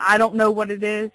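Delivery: ordinary talk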